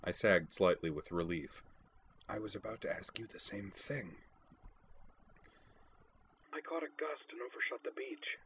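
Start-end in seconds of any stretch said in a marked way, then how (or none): 2.3-4.2 soft hushed reply. A narrator's reading. Hushed radio talk at night. 6.5-8.4 put-on higher, soft hushed girl's voice; higher and hushed, like over a radio; hushed young woman. A narrator's reading. Sakura whispering over radio.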